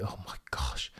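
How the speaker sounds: unvoiced, soft whisper. Real, not acted. Streamer reads a cheeky chat comment, whispering. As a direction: hush it like a secret.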